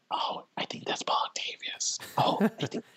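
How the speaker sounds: imitating hushed onlookers' whispers; whispering. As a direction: whisper it like a passer-by spotting someone known. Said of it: "you hear people whispering".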